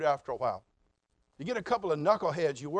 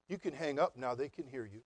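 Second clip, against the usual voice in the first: dry sarcasm; 4 semitones lower, 6 dB quieter than usual. Retold or imitated. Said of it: "Mister Sarcasm has an answer".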